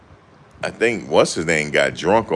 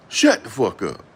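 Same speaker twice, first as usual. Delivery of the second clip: mock snap; 5 semitones higher than usual. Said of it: "Shannon was like".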